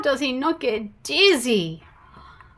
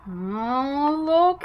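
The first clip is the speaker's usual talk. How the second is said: usual speaking voice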